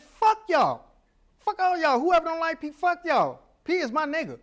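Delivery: high, loud, defiant call-out to the crowd; reliving a defiant shout from the stage; mock stage yell. Comedian yelling at the audience about the haters, loud and defiant.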